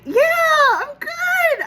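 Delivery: high, loud, elated reassurance; so excited. Retold or imitated. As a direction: burst out with giddy excitement. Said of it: "I am so excited".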